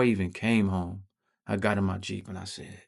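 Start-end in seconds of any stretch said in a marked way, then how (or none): none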